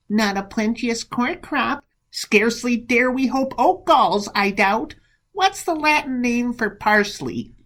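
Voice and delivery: high-pitched put-on prattle; mocking mimicry. Monk apes the rival's wise talk at table, high mockery. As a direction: ape a tedious companion in a high voice.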